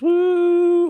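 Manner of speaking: high, drawn-out "woo"; sustained high whoop. Pretend. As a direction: let out a long high-pitched woo. Sound effect after finger snaps.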